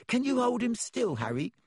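put-on high, eager child's request; high-pitched excited curiosity; fascinated. A narrator's reading. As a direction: ask keenly in a small boy's voice. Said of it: "fascinated, and raising his camera".

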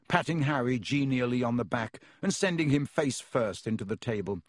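ordinary delivery, nothing marked

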